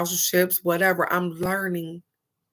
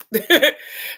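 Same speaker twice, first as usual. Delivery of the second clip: short rising chuckle, higher and louder; amused laugh; 7 semitones higher, 6 dB louder than usual. Real, not acted. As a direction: laugh at your own point.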